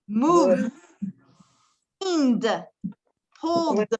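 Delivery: "moved, cleaned, pulled" each one syllable ending in voiced D. Shown as a demonstration. Teacher asks class to listen, "moved, cleaned, pulled" closing on D. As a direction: land on the final D of "moved, cleaned, pulled", E silent. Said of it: "¿Escuchan la D al final?".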